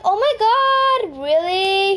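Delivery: high, drawn-out gushing; mock-delighted squeal of surprise; cheerful excitement. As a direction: act out the thrilled reaction she never gave. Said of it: "She was not even being cheerful".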